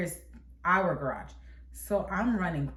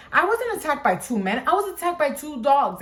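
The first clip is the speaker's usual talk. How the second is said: higher, insistent mock-upset protest; tearful mom, imitated; 6 semitones higher, 4 dB louder than usual. Mom explaining the dogs to children, lightly imitated tearful protest. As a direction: lightly plead it like a crying mom. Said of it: "she's crying".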